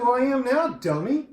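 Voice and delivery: high, loud mock-grumpy comeback; teasing retort. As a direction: snap back playfully at the friend who woke you. Groggy teasing reply to friend.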